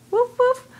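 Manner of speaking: high, bouncy woof woof; playful dog bark. Shown as a demonstration. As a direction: bark it brightly for a toddler. Parent modelling dog sound.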